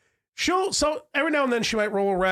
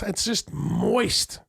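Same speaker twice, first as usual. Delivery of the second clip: drawn-out disgust; 3 semitones lower, slower than usual. Real, not acted. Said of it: "savoring gross description".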